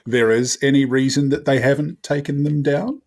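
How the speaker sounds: usual speaking voice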